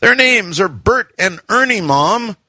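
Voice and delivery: high-pitched, lightly voiced protesting kid; demanding son. A narrator's reading. Son corrects mom about the lizards, mildly demanding. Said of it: "my son demanded".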